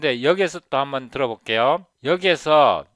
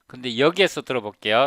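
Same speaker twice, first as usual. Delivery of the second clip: ordinary talk, nothing marked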